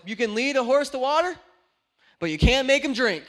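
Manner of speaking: loud, fed-up retort to grandma; mad outburst. Retold or imitated. Preacher retelling snapping at his grandma, mock fed-up. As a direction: fling the old saying out in frustration. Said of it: "I was so mad".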